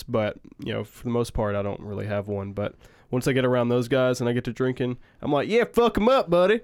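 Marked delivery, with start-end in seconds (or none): 5.5-6.6 put-on rowdy country accent. Drinking with rowdy country friends, rowdy country accent. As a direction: whoop it up like a drunk country buddy. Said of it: "get drunk and get around all my rowdy country-ass friends".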